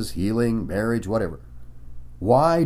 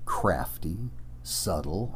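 usual speaking voice

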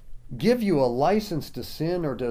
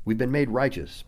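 ordinary delivery

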